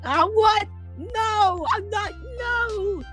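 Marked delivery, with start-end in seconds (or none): none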